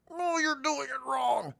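put-on the old mall boss scolding, in a high slow whine; annoying boss voice. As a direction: sneer it like a fussy mall manager. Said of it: "he said this in his annoying voice".

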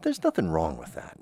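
ordinary delivery

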